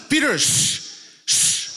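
high urgent shush; mock alarmed hush; hushing friends. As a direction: hiss it to silence him.

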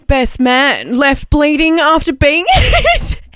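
slow, loud, laughing through the headline; laughing through words. Real, not acted. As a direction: try to read it while cracking up. Rereading the headline while cracking up.

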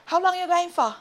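high-pitched guy calling out to a woman; slurring street guy. Retold or imitated. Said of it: "this guy is slurring his words".

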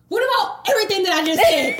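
loud, high-pitched mock outrage; indignant demand. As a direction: fire it back, exasperated. Acted quarrel with boyfriend.

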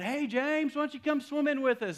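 high, loud friendly holler; calling out. Retold or imitated. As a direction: call over to a buddy from a passing car. Friends call out from passing car.

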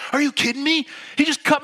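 high, loud outraged protest; mock road-rage outburst; exasperated driver. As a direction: act out a friend indignant at being cut off. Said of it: "throwing his hands in the air".

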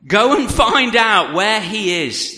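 loud, high, slow command; royal order. A narrator's reading. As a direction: give the order as a king. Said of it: "the king ordered".

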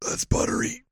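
whispered. Pretend. Savoring imagined breadstick flavor.

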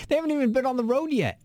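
high laughing disbelief. Real, not acted.

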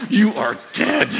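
slow, drawn-out mother's threat; hammed-up doom, each word spelled out; mock threat. Mom discovers stolen wheels.

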